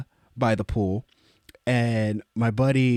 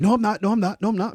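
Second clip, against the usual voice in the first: high, rapid-fire repeated protest; flustered denial; 8 semitones higher, 4 dB louder, faster than usual. Retold or imitated. Freaked-out guest denying he is a fanboy, flustered. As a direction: deny it too insistently, pitched up.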